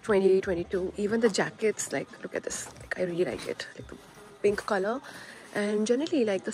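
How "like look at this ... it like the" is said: hushed whisper to the camera. Real, not acted. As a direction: breathe it out, barely voiced. Whispering in the shop.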